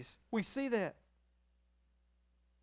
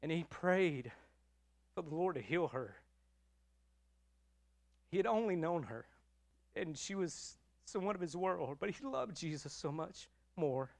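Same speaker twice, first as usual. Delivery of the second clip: choked up; 3 semitones lower, 7 dB quieter, faster than usual. Real, not acted. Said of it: "I'm sorry, I'm an emotional preacher".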